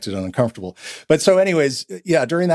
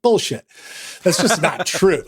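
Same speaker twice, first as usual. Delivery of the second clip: retold scoff; 7 semitones higher than usual. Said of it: "I was like".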